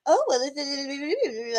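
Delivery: high, slow, drawn-out mimicry; mock smug. Mocking the man in the debate, smug imitation. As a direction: mimic a smug man's pompous babble. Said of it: "he's acting all smug".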